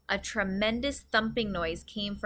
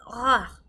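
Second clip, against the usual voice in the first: loud spluttering ugh of disgust; 7 dB louder than usual. A narrator's reading. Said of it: "she spluttered".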